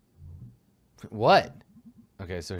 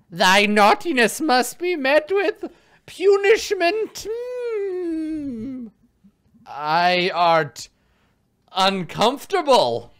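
put-on old hag; 10 dB louder, much slower than usual. Witch part.

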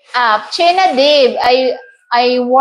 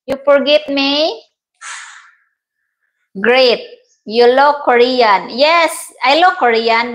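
ordinary talk, nothing marked

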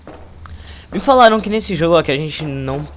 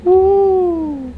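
startled gasp; 6 dB louder than usual. Real, not acted. Startled by door opening.

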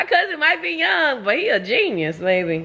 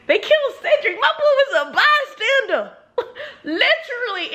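outraged disbelief; 9 semitones higher than usual. Real, not acted. Outraged reaction to the book.

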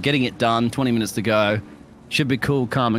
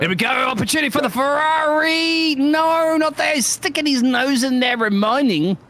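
excited shout; 14 semitones higher than usual. Real, not acted. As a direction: shout the overtake live. Live overtaking attempt on track.

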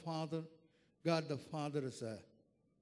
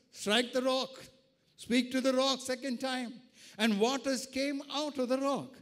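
loud, forceful sermon voice; impassioned preaching; 6 semitones higher, 7 dB louder than usual. Real, not acted. Preacher telling of the Red Sea, impassioned preaching. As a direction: drive each phrase home to the congregation.

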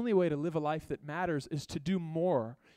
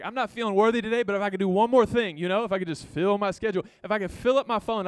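mock striving; 3 semitones higher, 7 dB louder than usual. Voicing an anxious busy person's thoughts.

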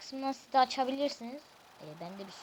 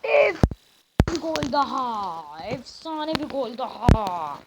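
football commentator; 6 dB louder, slower than usual. Acted. Commentating the goal.

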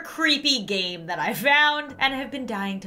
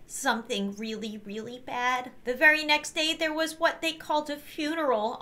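put-on high, childlike storytelling voice; sweet little girl telling her story; 5 semitones higher than usual. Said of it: "do a little girl voice".

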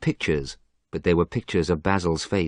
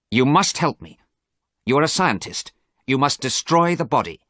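desperate demand; 7 semitones higher, 4 dB louder than usual. A narrator's reading. Dorian desperately demands help.

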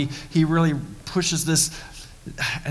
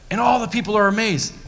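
ordinary talk, nothing marked

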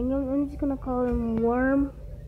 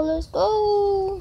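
higher, more eager let's go; eager repeat; 6 semitones higher than usual. Real, not acted. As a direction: lift the second go up, itching to start. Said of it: "Come on, let's go".

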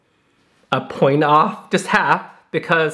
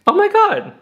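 amazed; 3 dB louder than usual. Real, not acted. Amazed at how fast it charges.

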